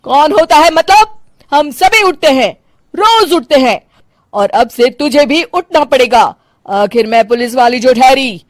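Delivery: strict retired cop scolding her daughter-in-law; stern policewoman mother-in-law. Acted. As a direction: lay down the house rules like an officer.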